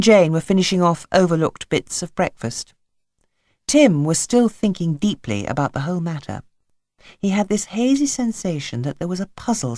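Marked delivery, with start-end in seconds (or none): none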